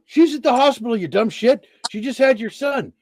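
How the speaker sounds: high, loud scolding with the news; exasperated excitement. Retold or imitated. Exasperated reply on the phone.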